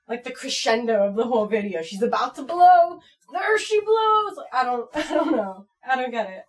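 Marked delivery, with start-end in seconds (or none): 1.8-4.4 mock dramatic climax. Teasing viewers about the poop scene, mock dramatic cry. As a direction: ham up the big moment.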